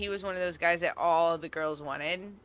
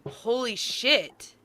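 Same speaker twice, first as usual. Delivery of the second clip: a stunned "holy shit" jumping up high on "really"; shocked, incredulous reaction; stunned disbelief; 5 semitones higher, slower than usual. Retold or imitated. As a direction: caught off guard by what she sees. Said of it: "was just like".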